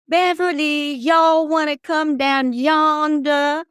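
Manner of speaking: high, loud, slow taunting Southern drawl, imitated; mocking Southern accent. Cousin's friends taunting young Beverly under her window, in a mocking Southern accent. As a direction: sing out the name in a teasing Southern accent, like a kid mimicking. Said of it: "made fun of her Southern accent".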